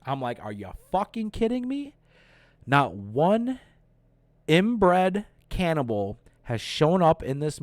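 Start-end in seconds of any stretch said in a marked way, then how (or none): 0.4-1.9 high-pitched, softer disbelief, climbing; incredulous. Real, not acted. Reviewer mad that the movie is nearly over, incredulous. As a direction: let the voice climb in exasperated disbelief. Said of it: "I am so mad".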